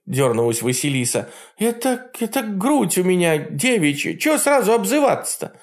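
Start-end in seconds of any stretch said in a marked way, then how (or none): 1.6-5.5 put-on high indignant young girl; huffy princess protesting; offended girl. A narrator's reading. Vasilisa offended.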